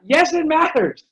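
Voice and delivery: high, loud acted retort; mock exasperation. Spouse contradicting the false indifference, mock exasperated. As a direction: burst out high, hammed-up and fed up.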